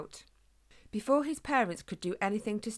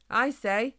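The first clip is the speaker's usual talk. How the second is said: a touch nervous, raised voice; slightly shaking voice; 5 semitones higher, 7 dB louder than usual. A narrator's reading. Small hedgehog addresses giant humans, slightly shaking. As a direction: try to get attention, a little unsure. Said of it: "his voice shaking slightly".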